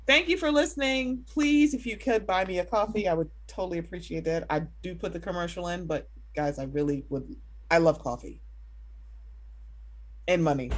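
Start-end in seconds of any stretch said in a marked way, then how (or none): none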